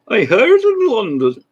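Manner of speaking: high, loud put-on voice; Emanuel Lasker. Presenter guessing how the chess writer sounded, hammed-up impersonation. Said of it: "What did Emanuel Lasker sound like".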